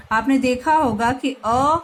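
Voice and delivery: ordinary talk, nothing marked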